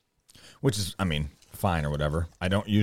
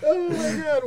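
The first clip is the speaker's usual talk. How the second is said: laughing; slower than usual. Real, not acted. Reacting to a funny map.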